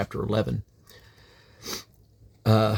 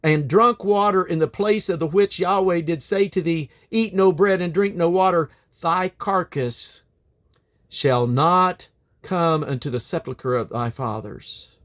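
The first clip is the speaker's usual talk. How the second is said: solemn, measured Bible reading; scripture reading; 7 semitones higher, 3 dB louder than usual. Acted. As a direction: read it like scripture in church, unhurried. Reads scripture aloud at length.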